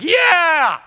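long, high, loud whoop; triumphant yell. Retold or imitated. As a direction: holler after a routed foe. Yells after the fleeing cat.

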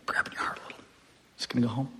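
soft whisper, barely voiced; whispered voice of God. Pastor voicing God, hushed whisper. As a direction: whisper it gently as God would. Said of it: "maybe God's saying".

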